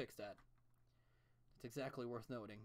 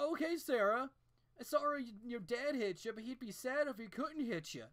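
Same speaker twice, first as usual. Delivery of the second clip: higher, louder mock reassurance; sarcastic mock comfort; 9 semitones higher, 7 dB louder than usual. Mocking a dad's excuse to Sarah, loud sarcastic. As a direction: exaggerate the fake comfort. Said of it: "mock quote of show's message".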